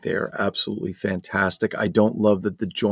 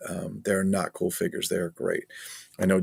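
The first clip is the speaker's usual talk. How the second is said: normal talking voice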